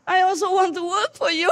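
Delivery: high, sad near-tearful plea; mock a sad billionaire on the verge of tears; sad, almost crying. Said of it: "looked suddenly so sad and, like, almost crying".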